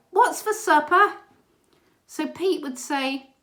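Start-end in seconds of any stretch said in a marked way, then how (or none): 0.1-1.1 loud, high shouted question; kids calling down. Retold or imitated. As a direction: yell it down the stairs like a hungry child.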